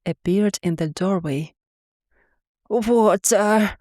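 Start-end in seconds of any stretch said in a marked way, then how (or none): 2.7-3.8 happy cry. A narrator's reading. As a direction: call out with joy in a beast's voice. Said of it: "I cried happily".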